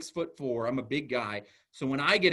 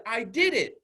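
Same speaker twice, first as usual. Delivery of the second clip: put-on louder, higher child's triumph; excited child; 8 semitones higher, 6 dB louder than usual. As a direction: imitate a kid proudly celebrating. Child's first celebration.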